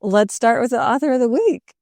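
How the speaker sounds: bright announcer read. Pretend. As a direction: announce it like a show segment. Echoes as announcer with jingle.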